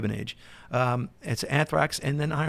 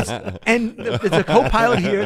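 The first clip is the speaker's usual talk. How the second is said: laughing; 7 semitones higher, 4 dB louder than usual. Real, not acted. Laughing while telling cockpit chaos.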